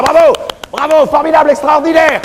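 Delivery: high excited bravos; mock crowd ovation, pitched high; cheering crowd. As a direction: cheer like a thrilled congregation.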